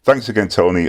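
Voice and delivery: ordinary talk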